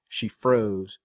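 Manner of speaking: ordinary delivery, nothing marked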